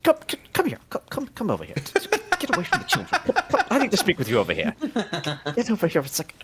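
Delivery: hushed-urgent come over here; urgent summons. Acted. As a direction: pull your friend aside quickly. Pulls companion aside urgently.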